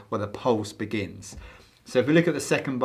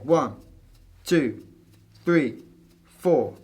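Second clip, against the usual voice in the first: spaced, accented one to four; counting four beats slowly; 3 semitones higher, 3 dB louder, much slower than usual. Shown as a demonstration. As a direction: pause between each beat. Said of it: "or you can just count four".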